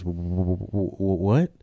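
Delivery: stuck on the first sound, rising into a question; bad stutter. Patient questioning the doctor's order, stuttering badly. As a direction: stammer repeatedly before getting the word out. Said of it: "he's got a real bad stutter".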